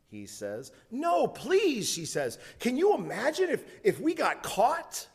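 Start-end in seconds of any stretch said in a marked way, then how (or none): 0.9-1.9 put-on much higher, begging girl's no; a high girl's plea pushing him away; pleading refusal. Girl refusing a kiss. 2.6-5.1 put-on high, quick, nervous girl's worry; worried girl. Girl refusing a kiss.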